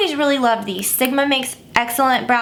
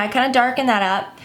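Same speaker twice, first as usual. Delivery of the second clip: usual speaking voice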